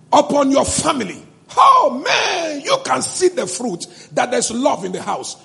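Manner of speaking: ordinary talk, nothing marked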